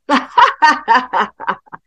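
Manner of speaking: hearty laugh. Acted. Ogres laugh in hell.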